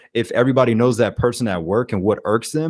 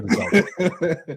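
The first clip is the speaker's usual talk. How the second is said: laughing; 8 semitones higher, 3 dB quieter than usual. Real, not acted. Laughing while retelling the reaction.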